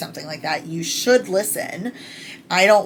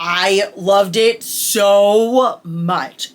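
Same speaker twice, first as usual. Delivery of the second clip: slow, louder, drawn-out swoon; 3 semitones higher, 8 dB louder, much slower than usual. Real, not acted. Fan swooning over the narrator, slow and loud. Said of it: "my knees went weak".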